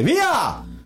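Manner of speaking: high, drawn call to clear; sudden high-pitched mock command; doctor's warning call. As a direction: imitate a doctor clearing the defibrillator. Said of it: "diceva semplicemente".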